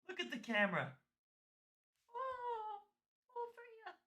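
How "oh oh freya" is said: sing-song squeaky doggy talk; high cooing pet voice. Real, not acted. Owner cooing to her dog Freya, high baby-talk voice.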